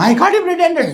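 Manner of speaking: high, loud repeated accusation; exasperated insistence. Retold or imitated. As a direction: insist at the top of your voice. Gopi insists after being questioned.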